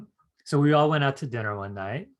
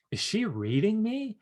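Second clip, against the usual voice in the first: astonished inner thought; 9 semitones higher than usual. Retold or imitated.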